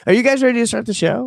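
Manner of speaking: high, fast showhost hype; excited host. Acted. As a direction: announce it like a host.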